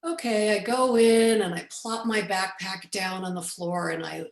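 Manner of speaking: ordinary delivery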